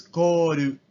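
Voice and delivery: koru slowly, ko-ru with tapped r; Japanese loanword. Shown as a demonstration. Teacher demonstrating coal in Japanese: コール. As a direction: stretch koru out syllable by syllable. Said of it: "se transforma en koru".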